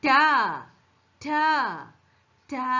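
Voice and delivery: isolated "ta", the sound of T; teacher modelling "ta" with pauses between; letter sound of T. Shown as a demonstration. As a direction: say "ta" alone as a phonics sound. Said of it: "T pronounce ചെയ്യുന്ന".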